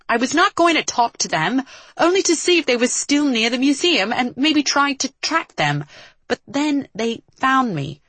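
high-pitched, insistent self-defence; defensive protest. Acted. Acted quarrel, defending himself.

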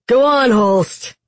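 slow, high, loud dare; calling out. A narrator's reading. Kaz daring the guard to shoot him, calling out. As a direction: call up to him tauntingly. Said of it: "he called".

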